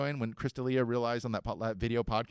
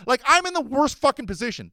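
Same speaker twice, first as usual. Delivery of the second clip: exasperated outburst; 10 semitones higher, 9 dB louder than usual. Real, not acted. Exasperated about his position.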